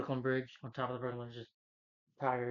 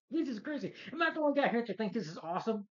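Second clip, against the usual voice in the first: high, loud mock excitement; hammed-up delighted teen; thrilled; 10 semitones higher, 3 dB louder than usual. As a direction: gush like a kid with new powers.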